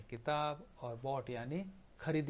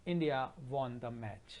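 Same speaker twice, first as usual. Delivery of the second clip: slow dictation; much slower than usual. Shown as a demonstration. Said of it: "teacher dictates sentence to class".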